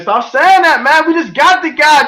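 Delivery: high, loud imitation of cousin; exasperated protest. Cousin telling him to chill out, exasperated protest. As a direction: pitch it way up, protesting loudly.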